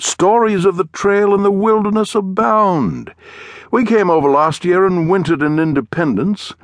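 high, effusive English gentleman; English accent. A narrator's reading. Englishman recounting his journey west, in an effusive English accent. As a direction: chatter on grandly in a high-pitched English accent. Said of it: "spoke in a strange accent".

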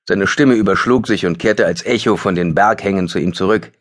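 ordinary delivery, nothing marked

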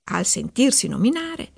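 plain reading voice

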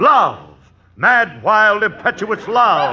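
mock-grand burst of passion; passionate declaration. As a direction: swoon into it like a stage lover, slowing on the last words. Husband declares passionate love.

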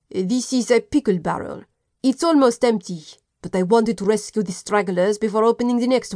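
put-on a light French accent, proud of his pantries; lightly voiced French chef explaining; French cook's accent. French cook showing the inspector his stores, mild French accent.

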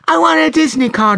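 put-on little girl kicking up a fuss; high, loud, stubborn child voice; demanding little girl. A narrator's reading. Child insisting in a tantrum.

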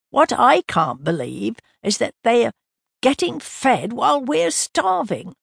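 put-on complaining like a hungry child; plaintive. Doug complaining they feed the dog while he starves, plaintive. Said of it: "Doug said plaintively".

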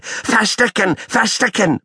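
very high yelled plea; squealed yell. Acted. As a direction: bellow it like an excited puppy. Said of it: "brüllt Paule".

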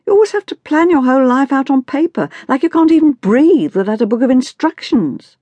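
put-on high-pitched exasperated teen rant; bored to exploding. A narrator's reading. Daughter berates her mother for planning everything, exploding with exasperation. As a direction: burst out in fed-up frustration. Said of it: "Grace was now bored to exploding point".

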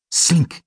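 short, high imitation of a metal flap; flap sound. A narrator's reading. Narrator imitates the door flap opening, clinking noise. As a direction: voice the flap swinging open, pitched high. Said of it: "machte die Klappe".

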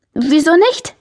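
tentative answer, lifted high into a question. A narrator's reading. Girl trying out an answer she hopes is neutral, tentative. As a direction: try the reply out, pitch rising. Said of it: "antwortete ich versuchsweise".

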